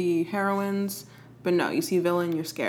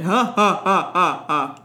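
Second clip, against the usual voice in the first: villain laugh; 6 dB louder, slower than usual. Pretend.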